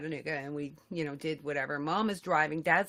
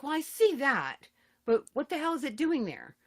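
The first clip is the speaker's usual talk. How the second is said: exasperated; 6 semitones higher than usual. Retold or imitated. Exasperated reply retold.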